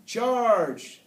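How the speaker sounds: high, loud yell of attack; long, high-pitched rallying shout; yelled battle cry. A narrator's reading. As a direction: yell it out like you're storming in.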